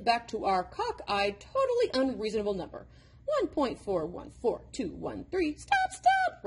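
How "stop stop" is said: high, pleading cry of stop; boy cries out, much higher. A narrator's reading. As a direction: burst out to make it end. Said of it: "Robert cried".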